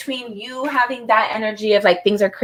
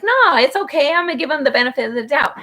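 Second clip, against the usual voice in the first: dismissive self-talk; 5 semitones higher, faster than usual. Retold or imitated. Voicing the reader brushing off warnings.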